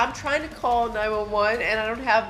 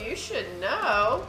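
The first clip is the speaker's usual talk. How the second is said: ordinary talk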